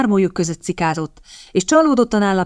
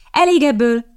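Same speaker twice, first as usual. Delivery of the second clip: raised, decisive command; firm; 7 semitones higher, 3 dB louder than usual. A narrator's reading. Kitty stops the men seizing boy, firm. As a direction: put a stop to it firmly. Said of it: "szólt rájuk határozottan Kitty".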